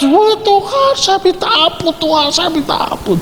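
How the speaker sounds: very high wailing plea; crying prayer. Retold or imitated. Man weeps to God for mercy, high wailing cry. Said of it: "Dia nangis".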